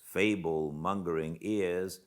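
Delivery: ordinary delivery, nothing marked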